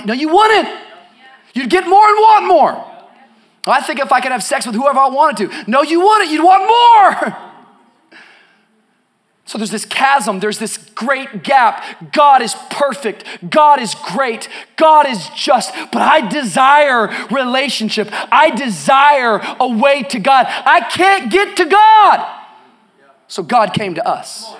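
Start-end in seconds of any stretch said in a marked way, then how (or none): 0.0-7.4 loud, high-pitched rebuttal to an imagined objector; forceful preaching. Acted. Preacher answering the congregation's imagined wishes, forceful. 12.1-22.2 measured phrases climbing into a drawn-out cry; building preaching. Real, not acted. Preacher voicing the longing to reach God, building fervour.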